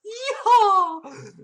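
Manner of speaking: loud, high, laughing yeehaw; cowboy yeehaw. Pretend. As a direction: whoop it like a cowboy. Joking about riding a Mountie horse.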